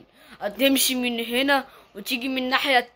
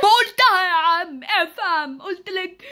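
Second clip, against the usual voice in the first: high slow impatient yell; annoyed shout; 6 semitones higher, slower than usual. Acted. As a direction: lose patience with the kid. Stranger loses patience.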